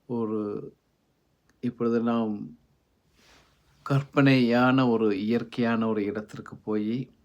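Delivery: slow, even meditation voice with long pauses; guided meditation. Acted. Host leading listeners away from daily duties, guided meditation. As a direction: lead them gently in, unhurried and measured.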